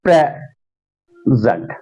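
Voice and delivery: Present in two slow parts, PRE then zent; long pause between PRE and zent in present; split noun stress. Shown as a demonstration. Teacher demonstrating to students, PRE stressed and split off. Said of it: "यहां मैं कुछ words पढ़ूंगा".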